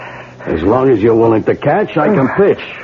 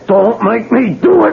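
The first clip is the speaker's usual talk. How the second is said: much higher, strained begging; desperate plea; 11 semitones higher than usual. Acted. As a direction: beg him not to force your hand. Gunman begs before shooting.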